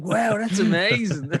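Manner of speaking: high, loud, slow gushing wow; awed tourist. Retold or imitated. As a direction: gush like a wide-eyed tourist. Impressed tourists react.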